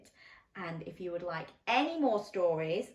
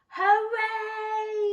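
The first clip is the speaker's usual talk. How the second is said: loud high sung cheer; loud high echoing cheer; echo shouting back; 9 dB louder than usual. Acted. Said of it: "the echo shouted back".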